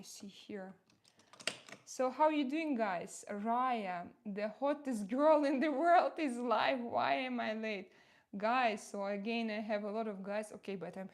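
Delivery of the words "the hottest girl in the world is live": usual speaking voice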